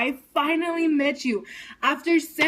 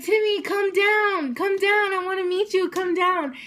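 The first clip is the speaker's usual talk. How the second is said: calling to him; 6 semitones higher than usual. Retold or imitated. Calling up to idol.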